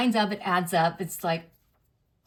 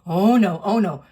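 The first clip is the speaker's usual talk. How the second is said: loud alarmed oh no; imitating her own panic, louder; panicked; 8 dB louder than usual. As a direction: blurt it out in alarm. Panicked retelling to doctor.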